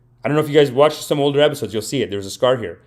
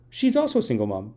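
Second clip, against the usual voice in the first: ordinary talk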